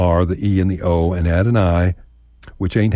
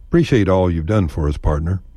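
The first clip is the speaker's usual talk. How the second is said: ordinary talk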